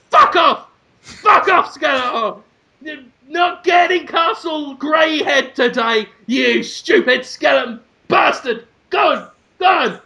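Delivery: high, angry cartoon shouting; hammed-up hero yelling. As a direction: chase off the villain with mock fury. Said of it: "And he says".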